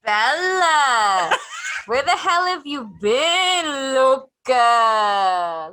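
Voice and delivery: high, slow, wailing banshee cry. Pretend. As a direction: wail it out like a mourning banshee. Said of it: "the Banshee yell that I would do".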